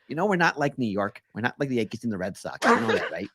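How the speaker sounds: lightly voiced low, reasoning fan; fan character. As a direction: play a fan pleading his case, lightly. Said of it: "Do they all talk like that?".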